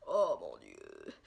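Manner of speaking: very low, slow creak; deep, drawn-out amazement; stunned awe. Real, not acted. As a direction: drop right down, drawing it out in disbelief. Stunned by the swatched colour.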